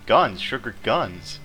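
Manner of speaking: loud burst of delight; excited shout. Real, not acted. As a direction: blurt it out thrilled. Excited discovery of sugar guns.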